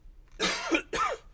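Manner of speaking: hacking cough after a hit; heavy coughing fit. Real, not acted. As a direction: cough hard and raspy.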